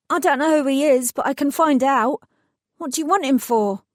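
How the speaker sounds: put-on a scared young worker trying to help; nervous young mechanic.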